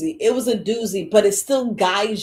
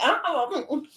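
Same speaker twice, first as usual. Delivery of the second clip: higher, clipped head-shake sounds; higher sassy refusal; refusing; 7 semitones higher, 4 dB quieter than usual. Pretend. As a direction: shut it down with a no-no. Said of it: "you be like".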